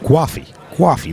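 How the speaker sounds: Coffee with a W, "kwoffee", as mock American; mock American accent. Shown as a demonstration. Commentator showing viewers what "we say", mock American accent. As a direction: say Coffee like a pretend American, W inserted. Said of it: "say it with a W to sound like an American".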